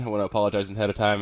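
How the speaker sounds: usual speaking voice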